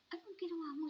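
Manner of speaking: high, loud alarmed question to her son; alarmed scolding. Retold or imitated. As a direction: demand an answer, startled and loud. Scolding son over fallen grandma. (mataas at malakas na pagsaway sa anak)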